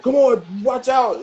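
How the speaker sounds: high loud call from the sidelines; yelling out. Retold or imitated. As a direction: shout a warning across the field. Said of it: "he always yelling something out".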